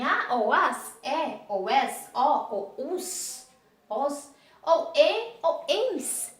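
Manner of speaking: endings spaced out slowly; slow listing. Shown as a demonstration. Teacher repeating the endings slowly for pupils. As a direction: lay out each ending slowly. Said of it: "teacher repeats endings slowly".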